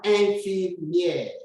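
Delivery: "infirmière" said slowly with a nasal "in". Shown as a demonstration. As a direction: drag "infirmière" out, nasal at the start. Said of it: "The I and the N gives you the sound N".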